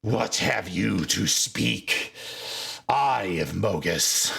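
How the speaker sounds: low, slow, annoyed growl of a voice; a big orc demanding an answer, irritated; irritated orc chief. Acted. Chief to the seer, slow irritated demand. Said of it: "looks over in irritation and goes".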